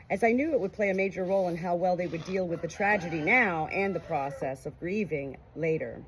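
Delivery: ordinary delivery, nothing marked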